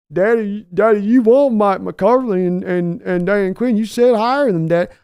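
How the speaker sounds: high, loud put-on son's voice; Steven answering. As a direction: answer Daddy like a cowed grown son. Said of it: "yelling at Steven".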